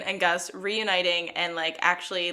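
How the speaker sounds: ordinary talk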